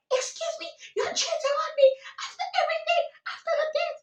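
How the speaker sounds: very high squealed mock outrage; imitated shrieking girlfriend. Girlfriend confronts her cheating boyfriend, high-pitched mock shriek. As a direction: squeak the complaint in a hammed-up falsetto.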